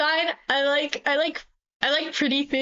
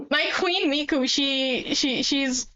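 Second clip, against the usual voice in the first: ordinary delivery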